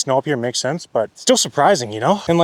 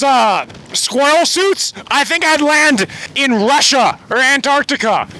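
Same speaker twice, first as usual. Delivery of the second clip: shouting over wind; 10 semitones higher than usual. Real, not acted. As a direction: yell over the roaring wind. Yelling in strong mountain wind.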